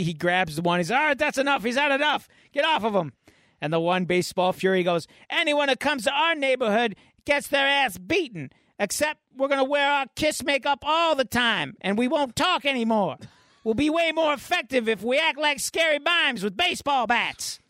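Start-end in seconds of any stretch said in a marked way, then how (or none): 0.9-3.1 high, urgent breaking up a fight; mock police order. Acted policeman breaks up a beating. 5.3-17.6 high-pitched blustering threat; mock gang rant. Acted gang member's threatening rant.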